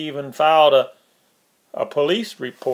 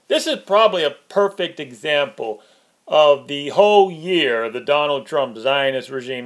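ordinary talk

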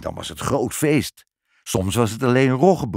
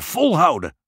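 high, louder squeak; squeaked urging; 9 semitones higher, 5 dB louder than usual. A narrator's reading. Father cheering on Snort at the rope, loud squeak. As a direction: cry it out in a pitched-up squeak. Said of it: "piepte vader".

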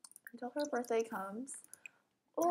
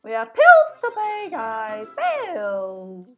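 loud laughing; 2 semitones lower, 14 dB louder, much slower than usual. Real, not acted. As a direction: blurt it out through a laugh. Laughing at her own slip.

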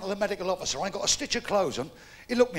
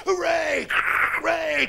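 crowd cheering; 7 semitones higher, much slower than usual. Acted.

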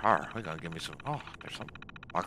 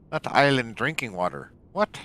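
shouting; 7 semitones higher, 11 dB louder than usual. Real, not acted. Yelling at a game label.

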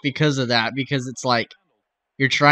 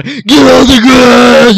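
huge drawn-out strongman roar; loud, high, stretched mock command; Arnold; 10 semitones higher, 14 dB louder, much slower than usual. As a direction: bellow it like an over-the-top action hero.

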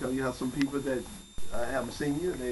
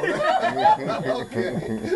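laughing; 9 dB louder, much slower than usual. Real, not acted. Host laughs at joke.

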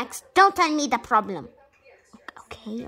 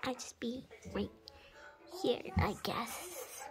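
soft unvoiced whisper; 6 semitones higher, 17 dB quieter than usual. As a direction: whisper to yourself. Patient whispering alone.

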